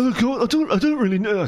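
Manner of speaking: high, dithering Frank Spencer whine; a hammed-up Frank Spencer, lost and flustered. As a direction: raise the pitch and dither helplessly. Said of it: "Why am I doing Frank Spencer?".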